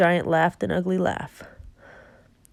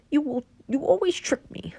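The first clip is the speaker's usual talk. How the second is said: 1 sulky whisper; 7 semitones higher, 3 dB quieter than usual. Acted. Giant sulks at Jack.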